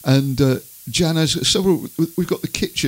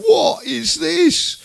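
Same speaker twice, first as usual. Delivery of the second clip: very high, slow, louder mock outrage; outraged wife; 4 dB louder, much slower than usual. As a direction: shriek it out slowly like an appalled wife. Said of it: "She came in and went".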